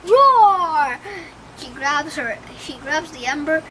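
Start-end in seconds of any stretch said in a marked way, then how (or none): 0.0-1.0 loud, drawn-out bellowing announcement; villain's shouted entrance. Pretend. Uma bursting onto the bridge, bellowing loud. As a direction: roar it like a sea monster arriving.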